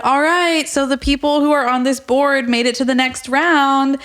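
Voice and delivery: host announcement. Retold or imitated. Host revealing who made the board, in a high announcer imitation.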